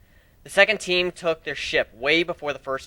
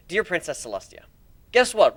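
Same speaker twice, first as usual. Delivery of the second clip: plain reading voice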